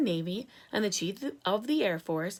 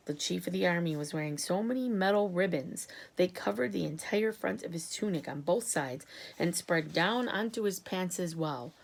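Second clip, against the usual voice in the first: ordinary delivery, nothing marked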